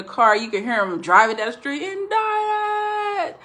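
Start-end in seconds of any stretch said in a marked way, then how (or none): 1.8-3.4 frantic high shriek of Indicted; hysterical Indicted. Retold or imitated. Dick running from his house, shrieking high in panic. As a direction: yell it like a man in total panic. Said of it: "I would say it the way he said it".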